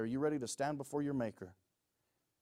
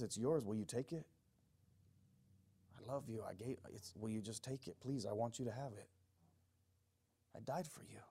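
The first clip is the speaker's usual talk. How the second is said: soft, hushed entreaty; quiet, intimate voice of a loving Savior; tender pleading; 2 semitones lower, 10 dB quieter than usual. Acted. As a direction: offer it gently and plead quietly. Christ tenderly pleads, acted.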